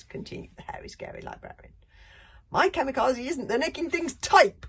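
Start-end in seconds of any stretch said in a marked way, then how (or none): 2.5-4.7 high, loud protest; loud cry. Acted. Bertha crying out for her daughter, high and loud. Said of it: "cried Big Booby Bertha".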